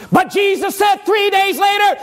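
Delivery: high-pitched pulpit cry, building; forceful preaching. Acted. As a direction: lift it into an anguished proclamation.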